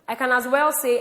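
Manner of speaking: ordinary delivery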